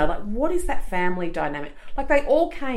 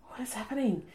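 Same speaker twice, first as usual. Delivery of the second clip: mock hushed; 4 semitones higher, 9 dB quieter than usual. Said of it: "I was just going".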